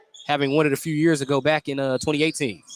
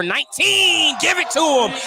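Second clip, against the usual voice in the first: high, loud rising shout; excited call; 9 semitones higher, 3 dB louder than usual. Real, not acted. Announcer cheering on the player chasing nineteen. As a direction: urge the shooter on at full voice.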